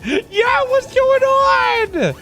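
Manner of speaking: high, drawn-out hollered greeting; hyped shout. Pretend. Streamer greeting a viewer who redeemed, hyped shout. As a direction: holler it high and stretch the last words.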